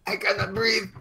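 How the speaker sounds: high, quiet and choking; gasping old man. Acted. Old man on the ground gasping to the cops, quiet. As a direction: wheeze it out with little air. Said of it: "you hear the old man".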